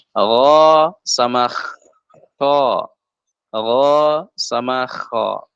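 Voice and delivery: ro and kho slowly from the upper throat; upper throat letters. Shown as a demonstration. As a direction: rasp ro and kho from the top of the throat. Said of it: "yang tenggorokan atas di sini". (ghain dan kha dari ujung tenggorokan)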